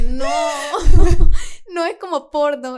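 laughing denial. Retold or imitated. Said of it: "Y yo dije".

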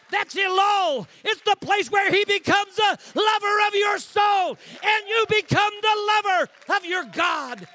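loud and high, shouted preaching; preaching at full force. Acted. Preacher calling the church to the altar, shouting. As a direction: drive it home to the back row.

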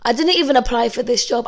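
ordinary talk, nothing marked

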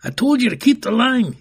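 high, loud scolding; guard yelling at an orphan who broke line; angry yell. Acted. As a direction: bark at the stumbling child. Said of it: "yelled the guard".

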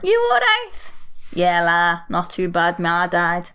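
Scouse accent dialogue; Scouse exchange. Shown as a demonstration. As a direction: play both Scousers chatting. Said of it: "you almost never hear me speak Scouse".

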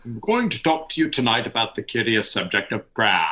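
put-on stately old-fashioned Oxford English; Lewis's Oxonian accent. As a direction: put on a grand Oxonian accent for a formal address. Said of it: "this regal sounding voice like this".

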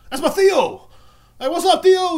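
high, loud cry of recognition; excited shout. Acted. Comic spotting Theo in a photo, excited shout. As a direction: shout out happily to someone you know.

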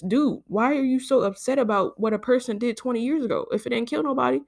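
ordinary talk, nothing marked

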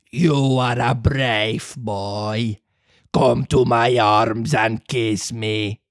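put-on embrace the boy gruffly; slow puppet master offering a hug; softened villain. Fire Eater softens.

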